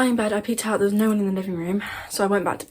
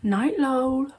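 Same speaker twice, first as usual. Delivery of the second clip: eerie higher-pitched imitation of mum; haunting voice; 5 semitones higher than usual. Mum at the bedroom door at night, haunting voice. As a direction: say it like a creepy mimic at the door. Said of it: "the most haunting voice I've ever heard".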